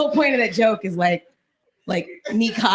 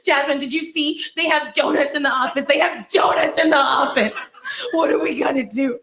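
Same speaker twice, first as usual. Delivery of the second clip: high, loud, laughing mock hysteria; hysterical coworker; 6 semitones higher, 4 dB louder than usual. Crystal panicking to Jasmine about office donuts, shrill mock hysteria. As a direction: play the panicking coworker, wailing and half crying. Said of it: "She's like".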